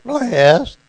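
loud, high mock Texas "blessed"; Abilene Texas accent. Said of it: "unless I said".

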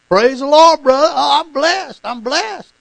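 loud, high, over-the-top church greeting; showy church praise. Acted. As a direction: belt it out like a zealous churchgoer.

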